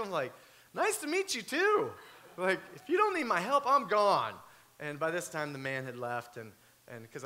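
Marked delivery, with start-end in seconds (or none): 0.7-4.3 much higher, louder, nervous politeness; awkward hurried goodbye. Retold or imitated. Preacher retelling meeting cussing grandparents, high and louder. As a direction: squeak it out, halfway out the door.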